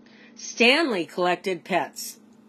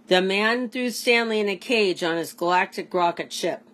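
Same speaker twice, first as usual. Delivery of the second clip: ordinary delivery, nothing marked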